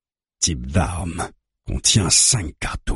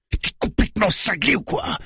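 very high, incredulous yell; frantic high-pitched outburst; bellowing. A narrator's reading. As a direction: shout it in outraged disbelief. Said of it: "bugle le barbu".

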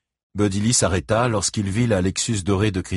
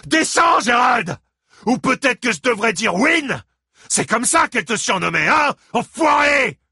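high, loud raging yell; enraged shouting; 4 dB louder than usual. A narrator's reading. Father shouts to Christine that Gérald killed their son. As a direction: scream out the accusation.

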